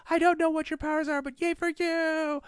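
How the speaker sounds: adoring fan yelling at Gauntlet, high and loud; screaming fan. Acted. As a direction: gush like a starstruck fan. Fan cheering Mesmer.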